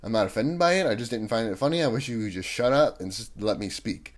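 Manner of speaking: ordinary talk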